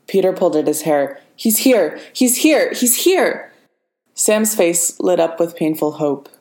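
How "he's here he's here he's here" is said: higher, urgent repeated cry; frantic warning, each one higher; panicked alarm. Acted. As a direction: blurt it out in panic. Peter panicking, Ross arrived.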